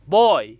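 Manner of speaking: बॉय very loud, in one sweep; one unbroken beat. Shown as a demonstration. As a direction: belt out बॉय as a single syllable. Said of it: "इसमें आवाज़ कहीं भी नहीं टूटी". (बॉय बहुत ज़ोर से, बिना तोड़े)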